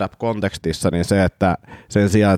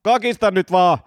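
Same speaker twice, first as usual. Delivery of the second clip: pitched-up, drawn-out demand easing toward usual voice; kuulustelija, tuskastunut; 7 semitones higher than usual. Acted. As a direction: press the suspect impatiently for a confession. Interrogator in acted scene. (tuskastunut kuulustelija)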